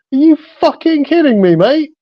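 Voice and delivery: put-on high-pitched, incredulous British accent. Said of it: "this guy with a British accent going".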